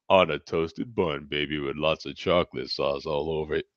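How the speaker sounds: low, soft put-on voice; low, indulgent character shtick; put-on "baby" voice. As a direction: savor it down low.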